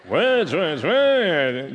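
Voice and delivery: playful mock folk-singer voice. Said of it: "I'll just do it as [name] would do it".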